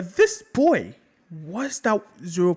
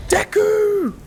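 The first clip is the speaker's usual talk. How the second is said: high, loud cry of his name; shocked scream; 7 dB louder than usual. Acted. Calling down after the fall.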